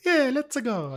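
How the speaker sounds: high-pitched cartoon Italian accent; playful high Italian accent; Mario. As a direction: do a squeaky Italian video-game plumber. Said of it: "No, if it's Mario".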